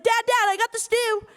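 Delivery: high-pitched eager son calling Dad; mock boyish excitement; excited calling out. Son returns calling to his dad.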